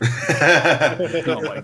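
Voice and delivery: loud, slow words through laughter; laughing. Real, not acted. Game master reacts to a player's aunt texting about a car, laughing. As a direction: say it while cracking up. Cracking up at aunt's text.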